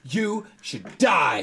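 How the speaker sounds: high, loud, drawn-out shout; imitated scream. Retelling an actor screaming at a co-star, hammed-up slow shout. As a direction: scream each word slowly in someone's face. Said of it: "he starts screaming at him".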